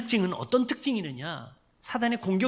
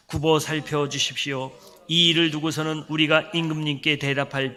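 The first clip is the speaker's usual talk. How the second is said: scripture reading; 4 semitones lower, 3 dB louder than usual. Acted. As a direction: read the passage together, measured. Congregation reads scripture aloud. (성경 봉독하듯 천천히)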